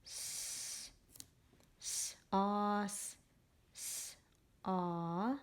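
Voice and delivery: hissed "S", then a held "aw"; slow phonics segmenting; sounding out. Shown as a demonstration. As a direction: separate the sounds of "sauce" with pauses. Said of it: "This word is sauce".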